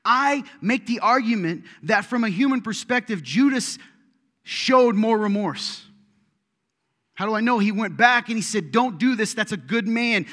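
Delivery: loud, high preaching voice; full-force preaching. Acted. Preacher booming at the congregation. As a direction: preach it out to the crowd.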